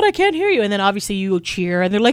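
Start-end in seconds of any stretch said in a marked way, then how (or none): 0.0-0.6 high-pitched call to the audience; playful stage call, pitched way up; mock crowd hype. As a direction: imitate a singer goading fans to cheer. Imitates singer goading the crowd.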